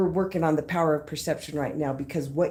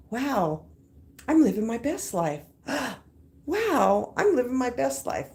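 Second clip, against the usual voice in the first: higher, delighted, gasping in awe; genuine wonder; 6 semitones higher than usual. Retold or imitated. The speaker shows her affirmation finally feeling real, awed and delighted. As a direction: marvel at it as if it just came true. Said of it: "slowly transitioning to".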